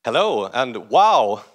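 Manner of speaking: ordinary talk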